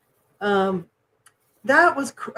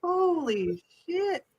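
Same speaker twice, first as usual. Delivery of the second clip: high, soft, drawn-out disbelief; retold amazement; 8 semitones higher, 6 dB quieter, much slower than usual. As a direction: stretch it out, stunned by the chaos. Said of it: "I was just like".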